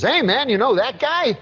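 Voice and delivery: put-on high, chummy old ballplayer voice; Uecker impression. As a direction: pitch it up like a folksy baseball announcer. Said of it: "I just love those Euchre impressions".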